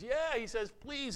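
high, thin voice from far off; a drunk calling back from a distance; lightly voiced faraway reply; faint distant voice. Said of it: "faint voice off in the distance".

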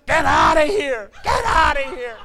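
high, loud furious shout; wife shouting after a cold-water prank, high and loud; angry yell. Retold or imitated. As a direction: yell at him to get out. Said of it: "Not in a nice voice".

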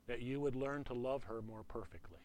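quiet, measured instruction from God; the Lord speaking. Retold or imitated. God telling a husband about his marriage, quiet and measured. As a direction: say it softly and evenly, as the Lord. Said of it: "I heard the Lord say to me".

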